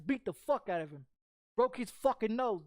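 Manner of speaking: ordinary talk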